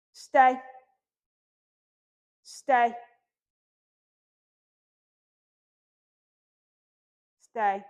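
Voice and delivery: loud, firm stay for the puppy; firm command. Real, not acted. Trainer firmly telling Tucker to stay. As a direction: plant the word, firm and loud. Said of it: "in a pretty firm tone".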